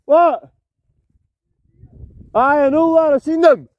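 high, loud reply shouted across the water; calling out. Real, not acted. Angler answering his friend across the bay, loud call. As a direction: call back over the distance.

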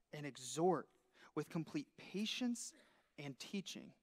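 reading Paul's charge to Timothy, soft and solemn; scripture reading. Acted. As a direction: read the verse reverently. Reads scripture.

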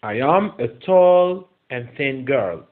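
very slow, word-by-word English; slow model reading. Shown as a demonstration. Teacher reading an example sentence to pupils, slow model reading. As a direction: read it out slowly for learners.

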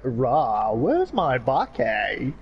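slow, drawn-out fake English accent; mock English accent. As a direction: mimic someone putting on English speech. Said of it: "act like they have an English accent".

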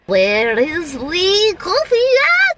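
a hammed-up craving that climbs to a squeal; desperate for coffee. Vlogger hamming up her coffee craving, slow rising mock wail.

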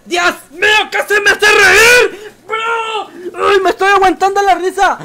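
grito agudo y fuerte, teatral y lento; exclamación exagerada. Pretend. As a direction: exagerar la sorpresa como si casi se riera. Reacción exagerada a un chiste. (el streamer reacciona en broma a un chiste, gritando agudo)

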